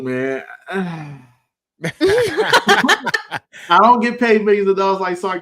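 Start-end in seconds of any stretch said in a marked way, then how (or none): 1.8-5.4 laughing. Real, not acted. Laughing at his own joke.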